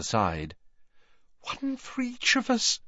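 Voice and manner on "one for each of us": terrified whisper. Acted. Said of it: "in a terrified whisper".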